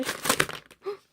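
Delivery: sharp whispered gasp, high; surprised gasp. Real, not acted. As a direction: catch your breath in surprise. Surprised by something in package. (놀라서 헉)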